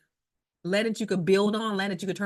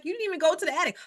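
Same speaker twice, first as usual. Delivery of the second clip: pitched much higher, imitating her own disbelief; incredulous retort; 10 semitones higher, much faster than usual. Confronting the inspector about skipping the attic, incredulous pitched-up retort. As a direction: retell the complaint with mock outrage. Retold quarrel with home inspector.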